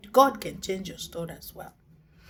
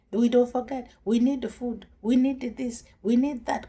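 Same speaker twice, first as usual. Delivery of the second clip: loud, higher whiny demands of the people; complaining crowd; 5 semitones higher, 5 dB louder than usual. Acted. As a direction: whine at Moses loudly, all demands. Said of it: "Complaining to Moses".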